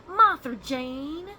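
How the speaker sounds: loud, slow Southern drawl, pitched high; big deep-South holler, stretched out slow; deep South accent. Shown as a demonstration. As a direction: call out the name in a drawn-out deep South accent. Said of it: "you say it in a Southern accent, like a deep South accent".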